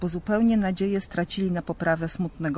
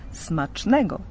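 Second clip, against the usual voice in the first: plain reading voice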